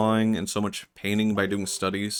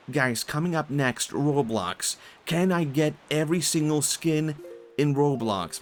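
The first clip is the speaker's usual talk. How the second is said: slow, raised-pitch, emphatic title read; hype video announcer; 6 semitones higher, slower than usual. Acted. Streamer pitching a Roblox video to viewers, hyped announcer. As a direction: tease the next video with put-on excitement.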